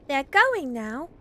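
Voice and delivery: put-on slow, high, loud child's announcement; excited little girl. Phyllis tells the family the visitors are going, excited. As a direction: call it out as a relieved child.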